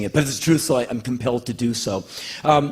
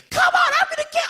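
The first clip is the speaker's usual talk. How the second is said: very high, laughing fake bravado; mock tough kid; much faster than usual. Mocking a fake brave version of himself, high and laughing. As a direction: squeal a fake tough-guy threat. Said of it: "some fake story".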